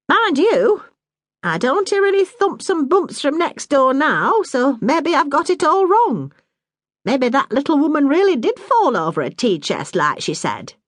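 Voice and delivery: a hint of a brisk northern mum; Bessie, motherly Yorkshire woman. Bessie muses aloud.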